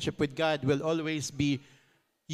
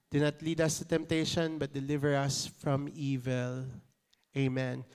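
recited prayer; 3 semitones lower, 4 dB quieter than usual. Acted. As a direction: recite it reverently, fading softer. Congregation recites the Lord's prayer.

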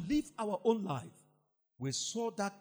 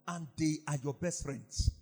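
usual speaking voice

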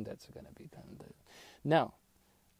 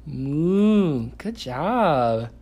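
put-on higher, louder sing-song to toddler; reading to a toddler; 6 semitones higher, 8 dB louder than usual. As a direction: coax a toddler with praise. Teaching toddler animal sounds.

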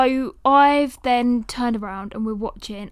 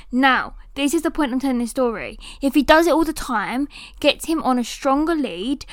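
usual speaking voice

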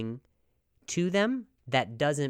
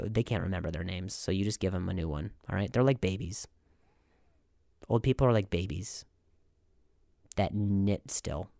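ordinary delivery

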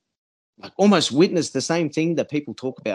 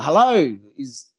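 loud call into the bush; 6 semitones higher, 4 dB louder than usual. Retold or imitated. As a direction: call out, higher and louder. Calling out into the bush.

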